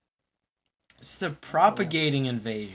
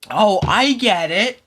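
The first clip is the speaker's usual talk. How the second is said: mock realization; 8 semitones higher, 12 dB louder, slower than usual.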